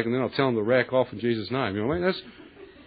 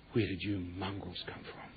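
hushed challenge; 5 semitones lower, 15 dB quieter than usual. Retold or imitated. Confronting demons at night.

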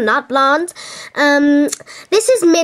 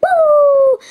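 high, loud whoop; excited whoop; 13 semitones higher, 5 dB louder than usual. Real, not acted. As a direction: cheer it out with glee. Excited tour opening cheer.

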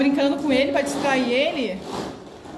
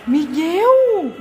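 much higher and louder, growing excitement; excited; 7 semitones higher, 4 dB louder than usual. Real, not acted. Mother excitedly tells the baby the car will move.

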